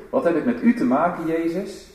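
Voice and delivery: a cry of defiance rising at the end; loud call. Shown as a demonstration. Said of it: "die roep".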